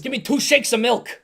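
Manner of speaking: a loud mock Brooklyn voice; mock Brooklyn accent. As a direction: tease him in a hammed-up Brooklyn accent. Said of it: "Heavy Brooklyn accent".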